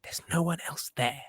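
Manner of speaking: hushed secretive whisper. Acted. Secret revelation to co-host.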